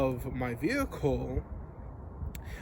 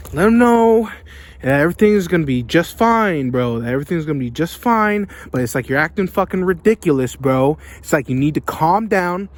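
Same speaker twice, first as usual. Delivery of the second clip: much louder, pushing hard on every line; loud rant; 13 dB louder than usual. Real, not acted. Telling viewers how to handle bosses, loud ranting. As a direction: vent it full volume at the camera.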